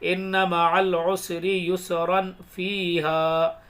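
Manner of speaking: slow recitation linking युसरन straight into फीहा; युसरन फीहा connected in one slow phrase; joined recitation. Shown as a demonstration. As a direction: keep the n of युसरन and run on into फीहा without a breath. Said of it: "अगर इसको आगे मिला के पढ़ेंगे".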